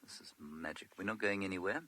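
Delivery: ordinary delivery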